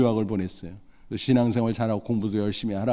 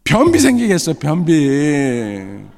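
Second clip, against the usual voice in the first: comic complaint; 6 dB louder, much slower than usual. Retold or imitated. Son's punchline complaint.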